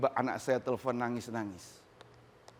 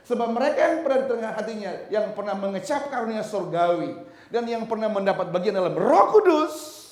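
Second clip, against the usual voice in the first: laughing read; 6 semitones higher, 6 dB louder than usual. Acted. Reading scripture with a laugh.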